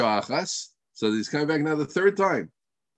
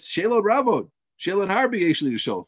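higher, insistent complaint; upset questioner; 6 semitones higher than usual. Retold or imitated. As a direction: push back, fed up, imitating the man. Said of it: "who got upset? The guy got upset".

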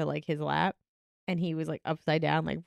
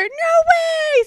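mock toddler shriek, much higher and louder; child's shriek; 10 dB louder than usual. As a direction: shriek the refusal like an angry kid. Toddler refusing the sticker.

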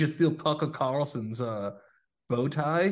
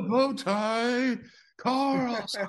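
high, slow drawn-out fighter name; mock ring announcer; 11 semitones higher, much slower than usual. Friend announces Tucker as a fighter, high and drawn out. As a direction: call it like a hammed-up ring announcer.

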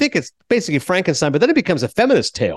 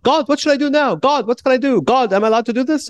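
put-on much higher, naive little-girl voice asking God; childlike Bella; 10 semitones higher than usual. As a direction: mimic a toddler-minded woman pleading sweetly.

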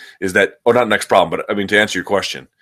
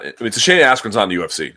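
usual speaking voice

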